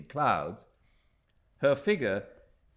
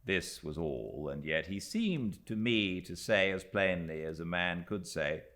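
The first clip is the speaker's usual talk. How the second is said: ordinary delivery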